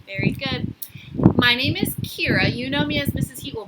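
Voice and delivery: ordinary talk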